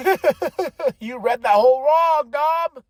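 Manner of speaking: high, loud, laughing tease; laughing mockery. Real, not acted. Fan ribbing Dom for misreading Liv, laughing mockery. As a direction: burst out laughing and call him out.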